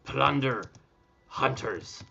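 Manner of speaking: very slow pirate dictation, pausing between words; hammy, drawn-out pirate speech. Acted. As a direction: compose a pirate letter aloud, word by word. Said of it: "now that it's pirate stuff".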